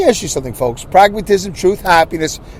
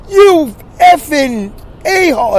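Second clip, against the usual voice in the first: higher, slow mock yell at the rude man; imagined yell; 10 semitones higher, 3 dB louder, slower than usual. Picturing yelling at the friend's father, a mock yell. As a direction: fling the insult like a pretend outburst. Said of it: "I should have yelled at".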